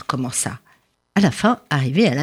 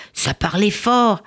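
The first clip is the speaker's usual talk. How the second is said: ordinary delivery, nothing marked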